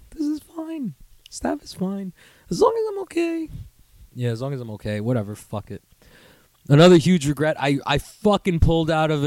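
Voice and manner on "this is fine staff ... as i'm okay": soft, very high squeak; feigned acceptance. Acted. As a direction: squeak it out as if barely coping.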